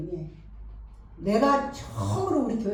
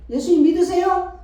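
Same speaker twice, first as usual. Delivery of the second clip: eager urging; 10 semitones higher, 8 dB louder than usual. Retold or imitated. Retold urging parents again.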